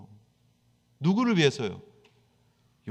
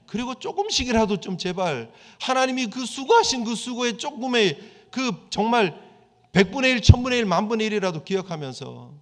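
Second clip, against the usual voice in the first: higher, louder pleading exhortation; preaching at full force; 5 semitones higher, 4 dB louder than usual. Acted. Pastor urging the congregation to remember, fervent.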